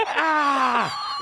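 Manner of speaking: high startled yell of pain. Retold or imitated. Comic reenacting his yell while being spanked. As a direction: let out a sharp high yelp. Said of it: "then I really yelled".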